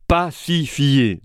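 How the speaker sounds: slow "pacifier", split syllable by syllable; jeu de mots découpé. Shown as a demonstration. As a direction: draw out "pacifier" as three separate beats, pa-ci-fier. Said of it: "en jouant sur les mots". (« pacifier » lentement, syllabe par syllabe)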